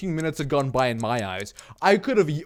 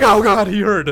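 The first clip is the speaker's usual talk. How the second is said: alarmed gasp; 6 semitones higher, 9 dB louder than usual. Retold or imitated. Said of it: "she looked at me like".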